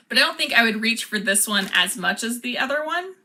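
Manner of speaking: normal talking voice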